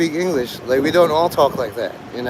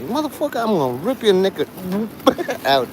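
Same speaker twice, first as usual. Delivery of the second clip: Patrice O'Neal; 5 semitones higher, 3 dB quieter than usual. Retold or imitated.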